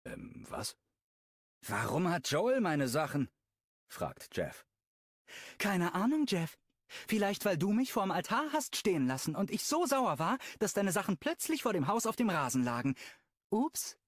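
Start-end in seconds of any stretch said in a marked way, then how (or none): 1.7-3.3 a hint of an irritated man, pitched higher; Jeff, lightly voiced. 5.6-14.0 higher, sugary and spiteful; Brooklyn, mock sweet.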